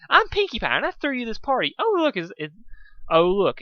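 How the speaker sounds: high, loud hyper party pony; bubbly Pinkie Pie. Acted. Pinkie Pie introducing herself to Dusk, high and hyper. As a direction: bounce through it, high and eager.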